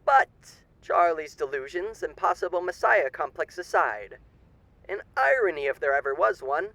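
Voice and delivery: silky, theatrical old-time radio announcer playing a charming demon; sly radio host. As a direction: purr every line like a grinning showman hiding menace.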